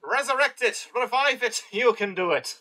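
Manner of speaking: high-pitched, playful urging; mock rallying cheer. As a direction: cheer them on like a hype crowd. Mock rallying appeal to patrons.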